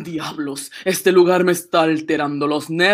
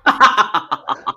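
hollow infernal cackle; a laugh from beyond the grave; eldritch horror; 5 semitones higher, 3 dB louder than usual. Acted. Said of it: "Aquella risa era infernal, hueca, espantosa".

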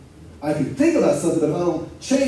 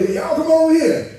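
calling out; 11 semitones higher than usual. Retold or imitated. Preacher imitating someone who calls folk over, calling out. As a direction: call them over in a raised, higher voice.

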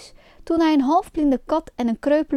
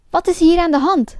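loud, higher demand; angry call; 5 semitones higher, 5 dB louder than usual. A narrator's reading. Puppet master storms on stage, calling out angrily. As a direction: burst in demanding an explanation. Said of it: "riep hij".

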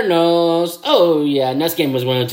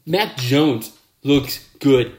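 ordinary talk, nothing marked

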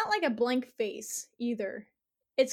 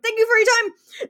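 much higher, louder, hammed-up awkward goodbye; awkward thanks; 10 semitones higher, 10 dB louder than usual. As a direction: chirp it out awkwardly before bolting. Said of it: "awkwardly is like".

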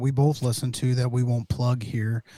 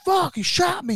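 very high-pitched imitated yelp of shock; retold shocked cry. Filmer just hit by rubber bullet, retold shocked cry. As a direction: act out a man who's just been hit. Retold reaction to being shot.

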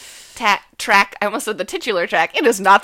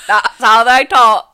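mock British accent; 10 dB louder than usual. Host shows how the band talks in a loud mock British accent. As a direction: exaggerate the British accent, amused. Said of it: "your One Direction accent".

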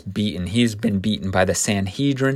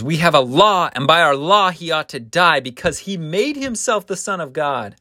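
higher-pitched, forceful argument; raised voice, indignant insistence; insistent accusers; 7 semitones higher than usual. Acted. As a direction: press the charge as the accusing crowd.

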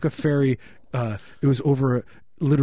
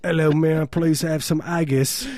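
higher, put-on British accent used as failed German; mock British accent; 5 semitones higher, 3 dB louder than usual. Tourist ordering in Germany, hammed-up British accent. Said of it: "Same thing, you go to Germany".

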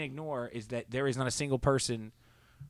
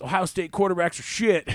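retold dismissive outburst, higher and louder; mock loud dismissal; 7 semitones higher, 6 dB louder than usual. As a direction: bellow it like a rival fan trashing the team. Fair guy's loud dismissal.

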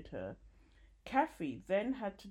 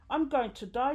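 higher, louder moan of dread; 8 semitones higher, 8 dB louder than usual. A narrator's reading. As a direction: moan it out loudly in fear. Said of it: "she moaned".

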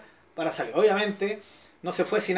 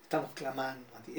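softer, lower, timid praying voice; mock meek prayer; 6 semitones lower, 13 dB quieter than usual. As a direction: mumble a tame prayer to mock it. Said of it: "eso no es clamor".

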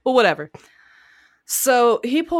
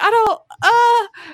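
much higher flustered husband voice; frantic husband; 7 dB louder, slower than usual. Retold or imitated. Husband answering his panicking wife, flustered. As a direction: play the panicked husband agreeing.

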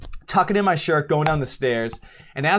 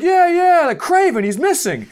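high loud shout back across the street; firemen shouting back; 5 dB louder than usual. Retold or imitated. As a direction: yell the reply over, half laughing.

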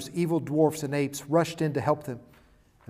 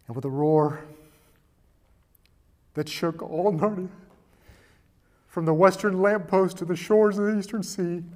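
climactic story reading; 3 semitones higher, 10 dB louder than usual. Acted. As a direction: build the charge until it roars. Climactic battle read with full force.

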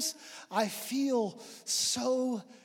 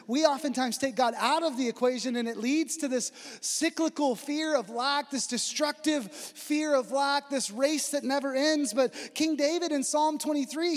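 rising, louder and higher preacher's drive; fervent preaching; 7 semitones higher, 6 dB louder than usual. Acted. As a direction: build the sermon up to full force. Preaching builds in force.

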